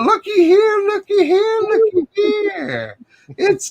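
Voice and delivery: high sing-out, stretching the last word; playful announcing. Real, not acted. Host spotting a guest popping in, playful high sing-out.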